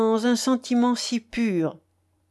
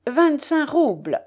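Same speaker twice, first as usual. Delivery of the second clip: high, mildly raised cry; 7 semitones higher than usual. A narrator's reading. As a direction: call out the sum in a high voice. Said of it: "cria Andreïev".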